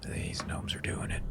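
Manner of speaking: whispered accusation; intense whisper. Retold or imitated. Joe insisting the gnomes are guilty, intense whisper. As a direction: whisper it like a grim certainty.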